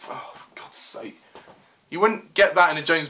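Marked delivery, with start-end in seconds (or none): 0.1-1.2 whispered, slow, despairing; exasperated whisper. Real, not acted. Reviewer despairing at a bad Bond line, whispered. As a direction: breathe it out in despair.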